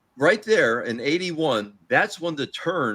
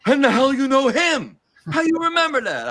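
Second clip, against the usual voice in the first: much higher, louder incredulous voice; imitating George Steele; 11 semitones higher, 4 dB louder than usual. George Steele amazed the fan remembers, much higher. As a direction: blurt out amazed disbelief. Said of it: "He's like".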